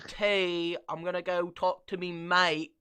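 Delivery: loud, lightly voiced mock British accent; mock a Brit talking about his mate. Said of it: "They talk like that".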